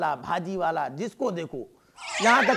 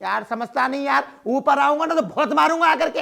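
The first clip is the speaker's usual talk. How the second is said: higher and louder, a comic threat; mock angry threat; 6 semitones higher, 4 dB louder than usual. Bald man shouting up at the mourners, mock angry. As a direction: shout up the stairs, fed up.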